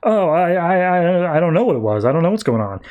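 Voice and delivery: stammering, mildly panicked retelling; mock freaking out. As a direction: imitate someone rattled, tripping over words. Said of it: "she's freaking out about that".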